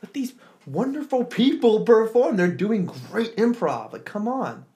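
exasperated outburst; angry. Retold or imitated. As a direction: vent your frustration at the heckler. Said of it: "I was just so angry".

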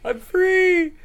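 high, bright cry of freedom; gleeful squeal. Retold or imitated. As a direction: squeal it like you just escaped. Meyer walked off in stocks.

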